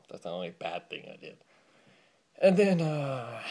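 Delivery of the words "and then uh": breathless, winded start; out of breath. Real, not acted. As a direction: gasp it out, winded. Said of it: "I'm out of breath".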